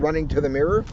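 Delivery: plain reading voice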